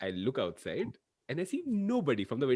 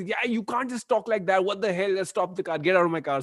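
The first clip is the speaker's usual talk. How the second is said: high-pitched mock indignation; angry retelling; 8 semitones higher, 4 dB louder than usual. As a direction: act out an irritated driver telling someone off. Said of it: "Vivek gets damn angry".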